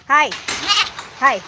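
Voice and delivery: ordinary delivery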